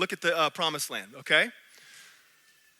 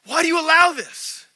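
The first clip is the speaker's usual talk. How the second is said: higher, louder pleading yell at God; mock yelling; 6 semitones higher, 4 dB louder than usual. Dreamer yelling at God about the devil, higher and louder. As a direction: retell the angry cry at God. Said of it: "I'm, like, yelling at God".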